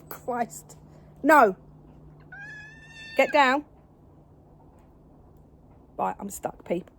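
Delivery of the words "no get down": loud repeated scolding no; firm loud no. Real, not acted. Owner scolding the cat on the table, loud repeated no.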